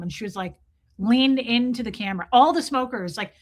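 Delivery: normal talking voice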